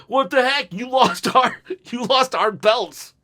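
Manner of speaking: high, put-on indignant complaint; hammed-up indignation, breaking into a laugh; mock outrage. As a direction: playfully act out an accusation. Acted outraged quote of Street Profits.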